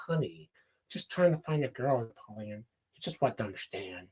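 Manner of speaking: low, soft, subdued mumble; mumbled low impression of an awkward guy; character imitation. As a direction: drop into a quiet, low movie-character voice. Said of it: "that's how he sounds".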